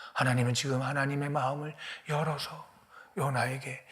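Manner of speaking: normal talking voice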